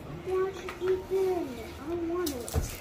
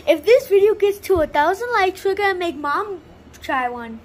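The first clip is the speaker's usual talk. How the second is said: loud announcement; 4 semitones higher, 8 dB louder than usual. Real, not acted. Announcing challenge to viewers.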